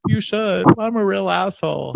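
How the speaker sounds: high put-on grovelling voice; mock self-abasement. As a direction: imitate a penitent agreeing to punishment. Acting out abject apology.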